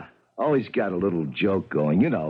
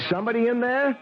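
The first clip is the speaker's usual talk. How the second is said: calling out; 14 semitones higher than usual. Acted. Doorman calling into apartment.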